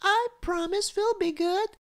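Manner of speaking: put-on very high, pleading little girl; child crying out. A narrator's reading. Cecily promises good behaviour, high-pitched begging. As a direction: beg squeakily, stretching out the final please. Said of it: "cried Cecily".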